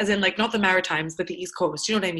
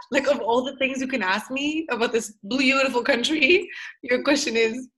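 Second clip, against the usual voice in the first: ordinary talk, nothing marked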